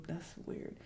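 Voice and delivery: whispered aside. Real, not acted. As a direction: mutter it under your breath.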